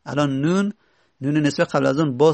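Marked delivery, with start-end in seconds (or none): none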